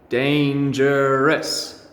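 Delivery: very slow, drawn-out dangerous; slow modelling. Shown as a demonstration. Teacher modelling the word for pupils, very slow. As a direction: stretch every syllable out slowly. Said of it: "Three syllables".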